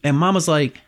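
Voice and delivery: normal talking voice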